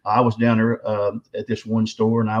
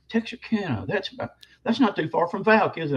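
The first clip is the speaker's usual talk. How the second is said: lightly voiced nurse, pitched much higher; a mock higher lady's voice asking sweetly; imitating the nurse; 8 semitones higher than usual. Nurse's question voiced in story.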